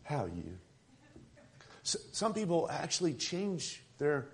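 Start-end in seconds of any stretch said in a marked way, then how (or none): 0.0-0.6 lightly drawled Southern "How you?"; a hint of a Southern drawl on "How you?"; Southern greeting. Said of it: "act like we're Southerners".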